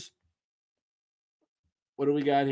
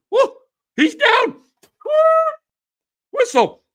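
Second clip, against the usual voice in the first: much higher, louder squeaky ref call; mock ref; 6 dB louder than usual. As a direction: shriek it like an overeager referee. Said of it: "the ref goes".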